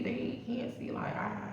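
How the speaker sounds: breathy whisper, voice gone. Real, not acted. Worrying that passing cops can't see her, dropping to a whisper. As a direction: trail off into a whisper. Fearing cops don't notice them.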